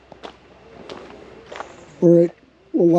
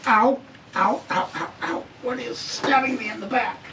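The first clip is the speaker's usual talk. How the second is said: real pain; 6 semitones higher, 5 dB quieter than usual. Real, not acted. Stabbed by something while crawling.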